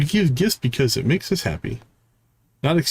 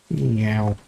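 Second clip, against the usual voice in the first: a little mewed cat sound, low; 3 semitones lower than usual. Acted. The cat answering its owner with a meow.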